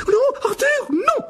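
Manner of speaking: very high, loud cry of alarm; shrieking. A narrator's reading. Mrs Weasley sees her husband fighting, shrieking. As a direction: scream at your husband to stop. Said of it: "se mit à hurler".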